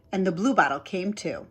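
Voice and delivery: plain reading voice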